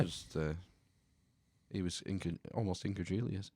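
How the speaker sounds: ordinary talk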